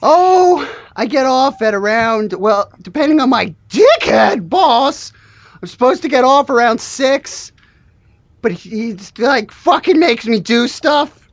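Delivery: aggrieved cartoon slacker griping; put-upon employee, cartoonishly exasperated; Shaggy-voiced worker. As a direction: complain about the boss in a goofy voice. Plays Shaggy-voiced worker griping about boss.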